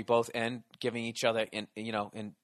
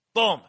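door slam boom; 5 semitones higher, 8 dB louder than usual. Retold or imitated.

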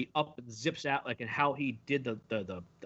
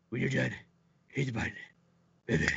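raspy, breathy Bale Batman imitation; mock Batman's out-of-breath rasp, pausing between phrases; 2 semitones lower, faster than usual. Fan mocking Batman's orders to Lucius, breathy Batman voice. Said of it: "he's still doing the".

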